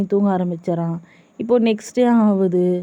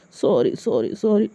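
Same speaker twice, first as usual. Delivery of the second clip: low, repeated begging apology; pleading; 3 semitones lower, 4 dB quieter, much slower than usual. A narrator's reading. Suga begs angry Jimin to forgive, pleading. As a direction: beg in a low voice. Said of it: "ரொம்ப கெஞ்சாத".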